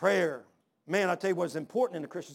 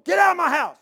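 much higher, much louder furious shout; angry yell; 10 semitones higher, 12 dB louder than usual. Retold or imitated. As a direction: roar him out of the house. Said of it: "He's yelling like that".

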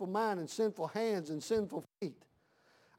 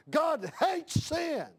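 preaching shout; 7 semitones higher, slower than usual. Real, not acted. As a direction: cry it out to the congregation, slow and high. Preacher shouting about sin.

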